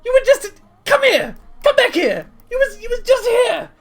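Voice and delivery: very high, sputtering disbelief; bewildered. Acted. Rock baffled that Ryla was just beside him, bewildered sputter. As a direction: stammer high and lose the thread in confusion. Rock confused by Ryla's sudden move.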